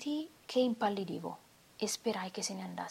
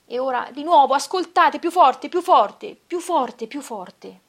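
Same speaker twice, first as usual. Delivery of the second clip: much louder and higher, near screaming; shouting; 6 semitones higher, 15 dB louder, slower than usual. Acted. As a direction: hammer each "più forte" as the heartbeat swells. Il battito lo porta a gridare. (assassino sopraffatto dal battito, grida sempre più forte)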